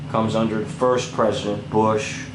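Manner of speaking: normal talking voice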